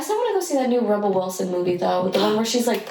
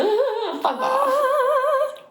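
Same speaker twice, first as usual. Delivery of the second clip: squeaky high note attempt; much slower than usual. Shown as a demonstration. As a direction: shoots up into a squeak, then gives up. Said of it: "She can do the".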